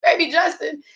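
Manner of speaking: ordinary talk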